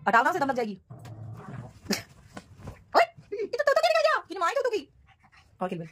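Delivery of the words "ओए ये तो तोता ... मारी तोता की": high exclaim. Pretend.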